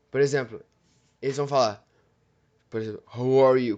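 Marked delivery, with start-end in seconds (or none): 3.2-3.8 a put-on accented "How are you"; imitated accent. Vlogger retrying the accent, louder. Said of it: "tom grave na voz".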